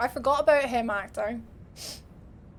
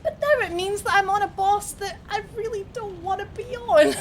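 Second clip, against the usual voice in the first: whiny, higher mock lament; a hammed-up whine, pitch raised; 7 semitones higher than usual. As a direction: moan about the boss, half joking. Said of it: "dreading the boss fight".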